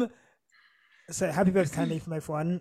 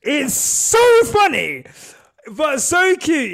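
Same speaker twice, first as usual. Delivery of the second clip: higher, louder and slow, delighted; gushing; 7 semitones higher, 9 dB louder, much slower than usual. Real, not acted. Gushing to the birthday girl about her message, delighted. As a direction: savour every word, thrilled.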